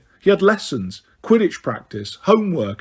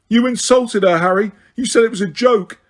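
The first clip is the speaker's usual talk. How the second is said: higher, louder angry shout; 6 semitones higher, 3 dB louder than usual. A narrator's reading. Upside-down Ron shouts at Harry for insulting Romilda, angry. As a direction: yell it in outrage. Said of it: "shouted Ron".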